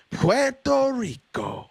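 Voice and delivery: mock Spanish accent. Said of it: "Is he changing his accent?".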